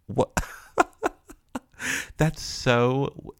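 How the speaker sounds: ordinary talk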